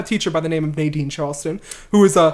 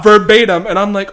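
ordinary talk, nothing marked

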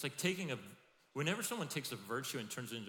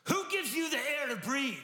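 shouted high and loud; a big preacher's cry; booming question; 12 dB louder than usual. Acted. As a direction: boom it out to the whole room. Preacher booms Solomon's rhetorical question.

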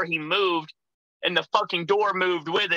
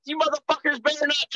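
high-pitched mock threat; pitched-up holler without full volume; retold angry yelling; 10 semitones higher than usual. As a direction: re-enact an outraged warning. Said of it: "I'm yelling inside this casket".

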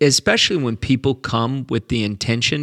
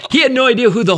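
laughing; 10 semitones higher, 4 dB louder, much faster than usual. Real, not acted. Laughing at the convert's confusion.

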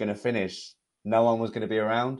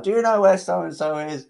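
much higher, lively question; mock driver asking; 10 semitones higher, 5 dB louder than usual. Driver stopping to ask for directions, much higher. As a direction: play a lost driver leaning out.

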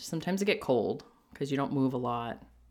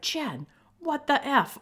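high, slow mock yell; imitated yelling; 11 semitones higher, slower than usual. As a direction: stretch it out as an imitation of a yelling girlfriend. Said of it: "but she's yelling".

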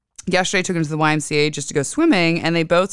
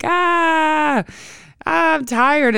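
whiny tired Saturn; 12 semitones higher, much slower than usual. Retold or imitated. Saturn personified whining that it is tired. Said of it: "and Saturn's like".

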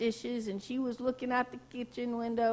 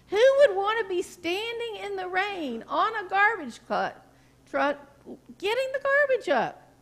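higher and louder, climbing up to a cry of pity; pitying wonder; 8 semitones higher, 3 dB louder than usual. Retold or imitated. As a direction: feel for the men out in the rain. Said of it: "And she thought".

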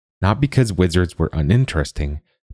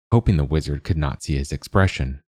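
plain reading voice

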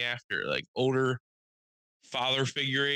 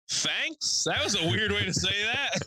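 baffled thanks; 9 semitones higher than usual. Retold or imitated. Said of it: "I was like".

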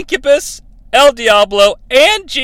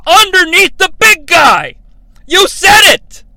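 loud, outraged high yell of You said it; shouted disbelief, pitch way up; exasperated shout; 10 semitones higher, 6 dB louder than usual. Real, not acted. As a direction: throw it at the screen in disbelief. Ranting at the script.

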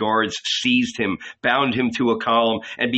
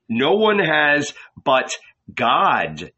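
usual speaking voice

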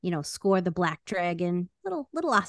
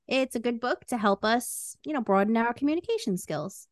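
normal talking voice

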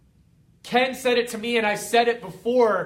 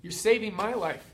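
much softer, near-hushed repeat; soft echo; 2 semitones lower, 7 dB quieter than usual. Real, not acted. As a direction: let it fall away quietly.

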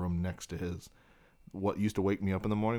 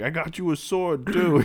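put-on proud drunk dad; 9 semitones higher, 7 dB louder than usual. Dad gives son a sword.

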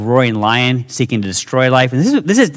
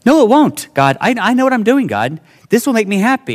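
whiny protest; 8 semitones higher than usual. Retold or imitated. Arguing with God as himself.

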